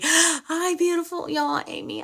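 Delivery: high, delighted hello; excited greeting. Real, not acted. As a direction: greet her with a happy gasp. Greeting her best friend live.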